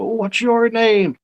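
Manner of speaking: high, slow mock Scatman voice; imitating [name]. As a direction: play the famous singer asking your name. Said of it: "I remember him saying".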